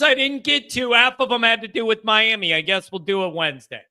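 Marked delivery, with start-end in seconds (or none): 2.5-3.8 quick, low "I guess we'll do it Wednesday"; resigned aside. Real, not acted. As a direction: toss it off fast and low. Sign-off remark, said twice.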